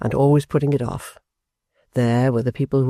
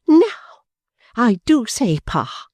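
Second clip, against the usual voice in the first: indignant cry; 9 semitones higher, slower than usual. A narrator's reading. Fanny scolding her father, high and indignant. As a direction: burst out in offended outrage. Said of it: "she cried".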